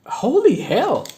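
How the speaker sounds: high, slow, stunned exclamation; amazed exclaim. Real, not acted. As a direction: let it burst out in disbelief. Stunned by absurd card.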